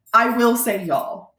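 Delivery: usual speaking voice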